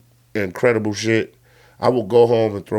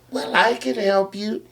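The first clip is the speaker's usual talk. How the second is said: high, softer bunny voice; rabbit voice; 9 semitones higher, 4 dB quieter than usual. Retold or imitated. Rabbit offering help to the T. rex, high mock rabbit voice. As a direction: offer it in a tiny, pitched-up voice. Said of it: "And then the rabbit goes".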